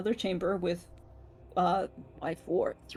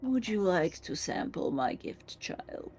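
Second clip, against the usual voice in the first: low gravelly growl; 5 semitones lower, 7 dB quieter than usual. Acted. Gravelly creature voice continues.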